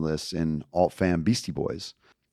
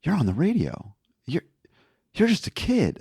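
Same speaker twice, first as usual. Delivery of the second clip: put-on older doctor; 10 semitones higher than usual. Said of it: "he's like".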